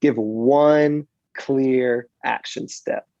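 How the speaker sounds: slow, clear call to action, each word weighted; measured preacher's call to action; slow, deliberate. Shown as a demonstration. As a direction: lay out every word of Give one clear action step slowly. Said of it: "demonstrates clear slow call".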